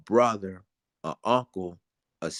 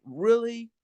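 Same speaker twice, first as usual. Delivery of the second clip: incredulous; 9 semitones higher than usual. Real, not acted. Outraged disbelief at allegations.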